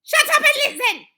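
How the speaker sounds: high, very loud angry snap; snapped. A narrator's reading. Grand High Witch silencing cheering witches, snapped. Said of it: "snapped the Grand High Witch".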